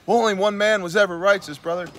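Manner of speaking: high, preachy mock churchgoer; mock pious churchgoer.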